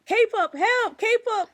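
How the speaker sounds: high loud hammed-up cry for help; mock panicked plea. Mocking the girls calling the police, a hammed-up high cry for help. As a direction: scream for help like a fake victim.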